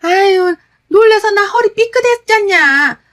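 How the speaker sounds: loud, high-pitched angry complaint; angry outburst. Acted. Mother-in-law blaming daughter-in-law for her wrenched back, angry yelling. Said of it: "더 큰 목소리로 화를 내는 거예요".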